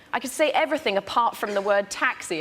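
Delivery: usual speaking voice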